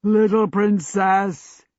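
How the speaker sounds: put-on big, slow, booming coo to a child. A narrator's reading. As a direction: giant djinn cooing loudly. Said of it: "he boomingly cooed".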